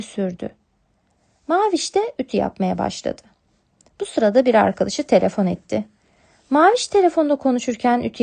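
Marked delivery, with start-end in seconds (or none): none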